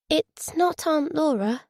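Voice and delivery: put-on high, slow, hesitant little girl; timid child piping up, high and small; small, nervous voice. A narrator's reading. Daughter interrupts her father on the phone, small and nervous. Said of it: "small, nervous voice broke in".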